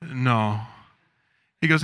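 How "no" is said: kindly refusing. Retold or imitated. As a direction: let him down kindly, trailing off. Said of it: "you know, kindly".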